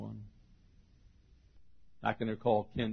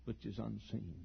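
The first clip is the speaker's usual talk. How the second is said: usual speaking voice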